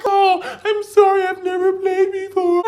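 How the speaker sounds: a mock sweet woman apologising to the children; mock-innocent Christina. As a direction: play it falsely naive and dainty. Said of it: "She's like".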